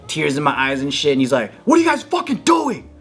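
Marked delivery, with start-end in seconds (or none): 1.6-2.9 much higher, alarmed parent voice; shocked dad. Retold or imitated. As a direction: burst in like a startled father. Dad catches them boxing.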